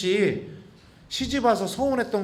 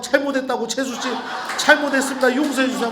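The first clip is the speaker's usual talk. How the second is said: higher, louder pleading apology; begging forgiveness; 5 semitones higher, 4 dB louder than usual. Retold or imitated. Priest holding her hand, begging forgiveness.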